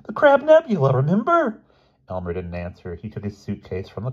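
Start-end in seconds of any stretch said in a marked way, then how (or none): 0.0-1.6 high, loud urgent prompt; howled reminder. A narrator's reading. Boy reminding his roommate of the alibi, high howled urgency. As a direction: yell it insistently. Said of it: "Bruno howled".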